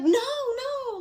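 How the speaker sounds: high, loud retold "no, no"; imitating her yelling at me. Friend on the ground snapping at helper, imitated loud yell. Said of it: "raising her voice at me".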